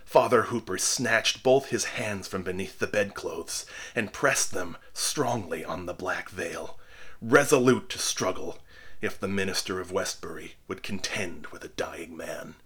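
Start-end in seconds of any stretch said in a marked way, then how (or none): none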